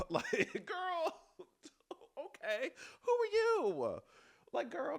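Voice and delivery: squealing high through real laughter; laughing. Real, not acted. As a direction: crack up mid-sentence, way up high. Laughing at her own imagined comeback.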